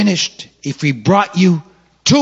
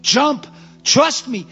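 high pleading exchange, father firm; coaxing a scared child off the board; father and son back and forth; 10 semitones higher, slower than usual. Retold or imitated. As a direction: plead and insist in turns. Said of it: "but I said".

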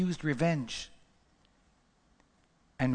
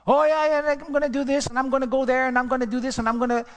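high, loud mock-eager promises; mocking promiser; 8 semitones higher, 5 dB louder, faster than usual. Preacher mocking people who promise but never do, high mock eagerness. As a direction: lampoon the big talker.

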